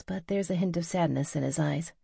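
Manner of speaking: ordinary delivery, nothing marked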